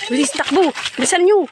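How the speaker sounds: loud urgent cry to run; panicked shout. Acted. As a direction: yell at them to get moving. Caught, kids flee.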